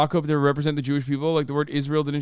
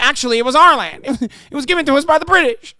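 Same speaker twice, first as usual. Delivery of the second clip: fast, high, loud mocking whine with laughter; mock him like a crying baby, laughing; laughing mock impression; 10 semitones higher, 7 dB louder, faster than usual. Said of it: "There's like".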